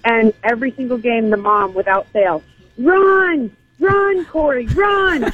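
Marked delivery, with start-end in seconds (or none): 2.8-5.3 high, urgent imitated cheer; sideline mom yell. Mom to her son at every soccer game, yelling. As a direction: holler like a mom urging her kid on. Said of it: "Useless stuff yelled from the".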